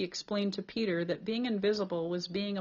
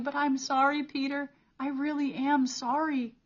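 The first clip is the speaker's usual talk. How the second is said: higher, louder, near-tearful apology; holding back tears; 7 semitones higher, 6 dB louder than usual. A narrator's reading. Cole apologizes to Peter, fighting back tears. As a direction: fight your tears as you plead. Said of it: "Cole fought back his own tears".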